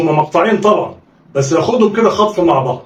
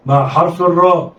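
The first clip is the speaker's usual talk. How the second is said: ordinary talk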